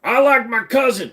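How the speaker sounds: high, loud hammed-up West Virginia twang; mock hillbilly West Virginia accent. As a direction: belt it out as a cartoon West Virginia yokel. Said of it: "Do the Virginia accent".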